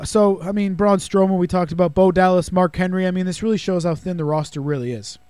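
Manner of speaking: ordinary delivery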